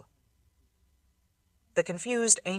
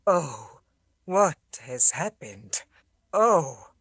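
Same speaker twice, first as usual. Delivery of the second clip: dazed ancient soldier; much slower than usual. A narrator's reading. Awakened statue warrior moaning.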